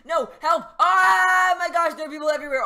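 panicked high shouts for help; fluttering cries for help. Real, not acted.

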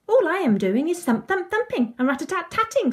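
high, bouncy, rhythmic words; eager demonstration. A narrator's reading. Eleanor encouraging Tom to join in, eager and high. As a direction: show how easy the drumming is. Demonstrates drumming to reluctant friend.

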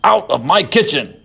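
irate chef voice; angry chef. Acted. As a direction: chase them out angrily. Chef keeps shouting.

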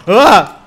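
sudden loud, high yell; short burst of fright; startled scream. Real, not acted. Player jolted by the game, startled yell.

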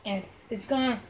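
ordinary talk, nothing marked

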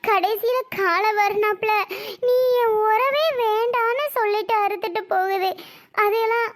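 high, tearful wailing protest; crying. Acted. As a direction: wail the hurt through sobs, pitched high. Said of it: "நீ இப்ப அழுது என்னாக போகுது".

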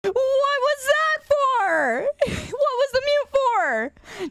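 high, drawn-out whiny protest; mock wail. Streamer protesting the mute, mock wail. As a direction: wail it slowly like a wronged child. Wailing after being muted.

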